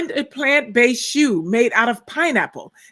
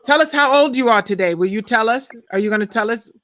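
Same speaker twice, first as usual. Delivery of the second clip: ordinary delivery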